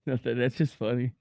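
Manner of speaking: nervous laugh. Real, not acted. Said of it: "I said I had a nervous laugh".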